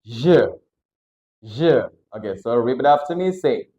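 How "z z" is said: Z said as "zhuh"; zh plus vowel. Shown as a demonstration. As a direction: buzz the zh into a schwa. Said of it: "Kita tambahin vokal".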